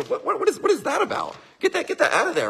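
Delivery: high, brisk voice brushing a thought away; mom's shooing voice. Retold or imitated. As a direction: chase the thought off like mom did. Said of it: "Go like this".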